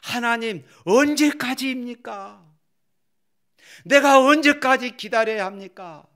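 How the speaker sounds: high, rising cry to God; anguished plea, voiced for the psalmist; pleading lament. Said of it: "이렇게 탄식하고 있지요".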